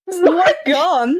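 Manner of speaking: laughing disbelief; amused laughing exclamation. Real, not acted. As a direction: laugh through the words. GM laughing at scene.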